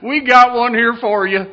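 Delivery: high, loud call through to the back room; hollered call. Retold or imitated. Librarian wife hollers to her husband, loud high call. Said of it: "she hollered in".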